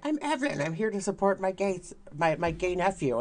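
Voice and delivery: high, sweet supportive grandma voice; drag grandma voice. Retold or imitated. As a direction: gush like a proud tipsy aunt. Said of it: "I'm like".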